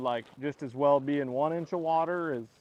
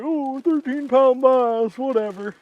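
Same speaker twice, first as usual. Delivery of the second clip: put-on slow, loud bored kid voice; spoiled kid voice; 10 semitones higher, 10 dB louder, slower than usual. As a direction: mimic a spoiled, unimpressed kid. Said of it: "he's so spoiled. It's like".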